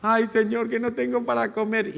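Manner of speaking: high, whiny begging voice, pitying oneself; whining prayer. Retold or imitated. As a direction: whine to God like a beggar. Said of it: "cuando queremos manipular a Dios". (quejido lastimero y agudo de quien suplica a Dios)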